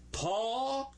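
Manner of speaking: "tall" slow and high, the L dropped. Shown as a demonstration. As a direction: model "tall" for the class to repeat. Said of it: "Let me hear you say it".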